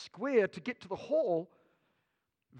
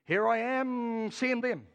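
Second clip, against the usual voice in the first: mock Moses dodging; 2 semitones higher, slower than usual. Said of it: "He says".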